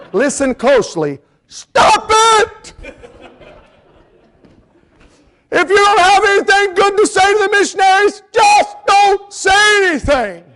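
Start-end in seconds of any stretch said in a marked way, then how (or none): 1.5-10.4 high, loud preaching shout; high slow pulpit shout scolding the critics; shouting rebuke. Real, not acted. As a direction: thunder the rebuke at the congregation. Preacher rebukes discouraging people.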